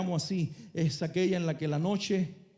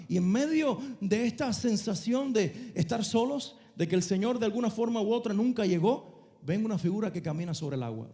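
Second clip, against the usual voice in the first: usual speaking voice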